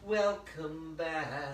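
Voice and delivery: very slow, stretched WELCOME BACK; drawn-out greeting. Real, not acted. Host welcoming little Lawrence back to the stream, long drawn-out greeting.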